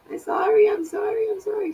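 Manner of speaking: sweet squeaky pet-talk apology. Real, not acted. Baby-talking apology to her pet.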